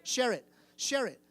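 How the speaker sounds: loud repeat-after-me; call-and-response. Shown as a demonstration. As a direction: drill the words into them. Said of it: "say it after me".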